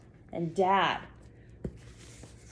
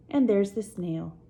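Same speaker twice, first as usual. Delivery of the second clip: ordinary delivery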